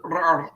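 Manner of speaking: one short trilled rrr on the tongue; rolled R. Shown as a demonstration. Asking cohosts if they noticed, trilling rrr. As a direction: trill a quick R for them. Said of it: "used the R's in, uh, in some way".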